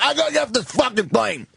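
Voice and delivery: put-on high, obnoxious drunken whine; mock a belligerent passenger's slurred bellyaching; Tony Clifton. Drunk passenger desperate to get off the plane, whiny belligerent yell. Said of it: "It sounded like Tony Clifton".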